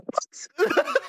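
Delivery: very high-pitched incredulous what; shocked reaction. Retold or imitated. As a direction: squeal it in comic disbelief. Recounts shocked reaction to guest.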